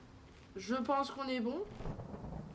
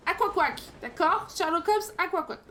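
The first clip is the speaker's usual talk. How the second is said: bad English accent; 5 semitones higher, 5 dB louder than usual. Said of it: "mon accent anglais est pourri".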